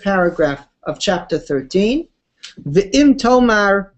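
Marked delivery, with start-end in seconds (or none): 2.7-3.9 slow, deliberate reading of the Hebrew; slow text reading. Shown as a demonstration. Teacher reading the text aloud, slow. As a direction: read Ve'im tomar out slowly, word by word. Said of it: "reading the text slowly".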